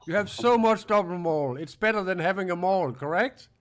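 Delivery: loud, high-pitched teasing jab; loud mocking. Real, not acted. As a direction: needle him loudly and high. Heated mocking jab in argument.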